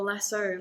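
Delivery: lasso said "LASS-oh"; spelling pronunciation. Shown as a demonstration. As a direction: end lasso on an "oh" sound. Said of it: "It's spelled lasso".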